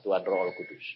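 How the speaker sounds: ordinary talk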